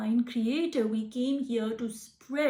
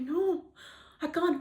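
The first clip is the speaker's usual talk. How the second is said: higher, anxious pleading no; pretend panicked refusal; 5 semitones higher than usual. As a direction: plead as if you truly can't. Imagined self refusing pharaoh.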